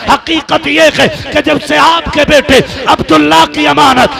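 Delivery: high, full-volume emotional cry; acted tearful confession. Weeping Amina confesses to her father-in-law, acted tearful cry. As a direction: build an impassioned wail to a climax. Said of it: "Amina ने रो के कहा था".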